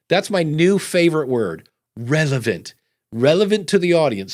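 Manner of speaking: usual speaking voice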